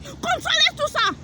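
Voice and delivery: high, slow, firm warning; emphatic command. Real, not acted. Preacher warning the crowd, slow and high. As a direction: lift it high and drag it out.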